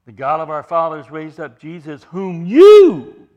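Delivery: "you" in "whom you" hit loud; emphatic you. Shown as a demonstration. Preacher accusing the Sanhedrin, "you" shouted. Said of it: "it's emphatic".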